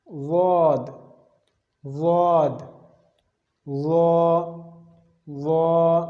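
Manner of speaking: each letter said slowly twice, apart; letter drill. Shown as a demonstration. Teacher leading letter practice for students. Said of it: "چاروں حروف کی اب practice دوبارہ کر لیتے ہیں".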